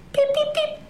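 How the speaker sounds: very high hungry chick peeps; high little bird peeping. Acted. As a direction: peep like a hungry baby bird. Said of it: "goes the little bird".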